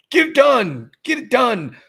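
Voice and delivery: loud, high mock pep-talk chant; mock rah-rah. Mocking Derek Carr's pep talk, loud high fake rah-rah. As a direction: parody a fake cheerleader. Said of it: "fake rah-rah".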